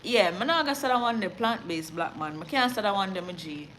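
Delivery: broad Jamaican patois, playful; raw Jamaican patois. Shown as a demonstration. Newsreader teasing chat in Jamaican patois. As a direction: slip into thick Jamaican patois. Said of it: "It's a code switch for me".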